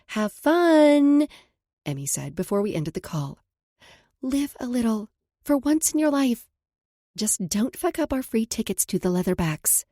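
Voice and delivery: a hint of a teasing friend; friend's voice.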